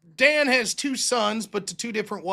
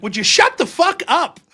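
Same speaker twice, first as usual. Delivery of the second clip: higher, louder irritated outburst; annoyed wife snapping; 8 semitones higher, 6 dB louder than usual. Retold or imitated. Wife fed up with husband's TV jokes, snapping. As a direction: snap at the husband to stop. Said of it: "my wife would be like".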